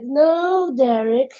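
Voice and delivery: stretched words starting high; patronizing, sarcastic. Acted. Second guy answers offended Derek, patronizing sarcasm. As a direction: draw it out with fake reassurance. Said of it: "patronizing and sarcastically".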